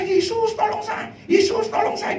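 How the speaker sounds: high, loud imitated cry to Jesus; retold desperate shout. Preacher re-enacting a critical patient's plea to Jesus, imitated high shout. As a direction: call out for rescue, pitched high. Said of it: "itu saya neriaki Yesus".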